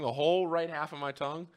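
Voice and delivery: ordinary talk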